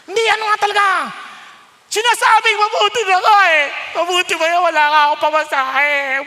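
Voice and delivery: loud, high exasperated retort; exasperated outburst. Acted. A person dropping the mask, loud and exasperated. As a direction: blurt out the truth you were hiding. Acted pushback, person admits hardship.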